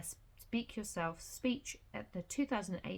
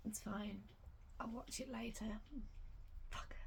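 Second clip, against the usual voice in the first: whispered self-consolation; whispering; 11 dB quieter than usual. Real, not acted. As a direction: whisper it to yourself. Muttering to herself after missing live.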